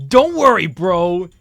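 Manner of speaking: loud confident reassurance. Acted. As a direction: shout it out cockily. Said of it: "Jontron assured him".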